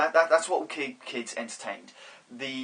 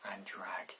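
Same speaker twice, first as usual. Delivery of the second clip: drops to an unvoiced whisper, much softer and lower; whispered drag; 5 semitones lower, 14 dB quieter than usual. Real, not acted. Director grumbling about a dragging bad play, whispered. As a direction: let it drain away into a whisper.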